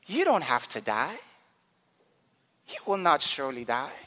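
low, soft tempter; a hint of quiet persuasion; lightly voiced serpent. Serpent tempting in the garden, lightly voiced. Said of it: "The serpent in the garden".

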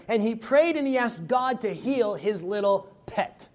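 ordinary talk

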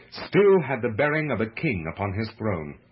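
plain reading voice

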